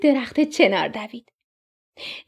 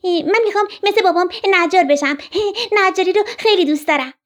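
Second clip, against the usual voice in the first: higher, eager little woodpecker; child-animal voice, pitched up; young woodpecker; 6 semitones higher, 3 dB louder, faster than usual. A narrator's reading. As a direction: pipe up as a keen baby bird. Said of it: "بچه دارکوب گفت".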